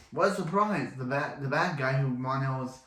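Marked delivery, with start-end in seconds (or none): 0.1-0.9 sarcastic, higher-pitched mock surprise. Real, not acted. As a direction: feign astonishment at the obvious. Mock surprise at a predictable twist.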